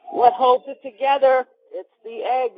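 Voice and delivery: hammed-up drawn-out reveal; mock climax confession. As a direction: cry out the secret in mock ecstasy. Acted climax of giving away recipe.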